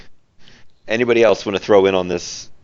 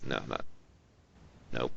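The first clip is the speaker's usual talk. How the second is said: ordinary talk, nothing marked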